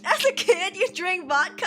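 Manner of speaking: high, loud shocked outburst; shocked disbelief. Retold or imitated. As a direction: blurt it out in total disbelief. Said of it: "And I was like".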